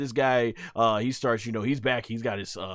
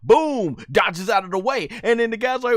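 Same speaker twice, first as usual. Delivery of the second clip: high, excited fight commentary; live play-by-play; 10 semitones higher, 7 dB louder than usual. Acted. Fan narrating an anime fight to viewers, hyped play-by-play. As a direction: call the action like a ringside announcer.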